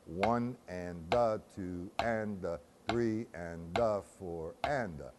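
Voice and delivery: slow, evenly spaced triplet count; measured rhythmic count-along; counting in time. Shown as a demonstration. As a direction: count each syllable out slowly on the beat. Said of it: "or count rather, and play".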